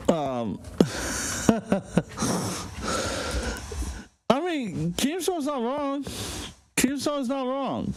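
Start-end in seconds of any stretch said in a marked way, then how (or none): 0.6-4.0 breathy, mostly voiceless chuckle; real laugh. Real, not acted. Host still amused by the rant, breathy laugh. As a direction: laugh while searching for words.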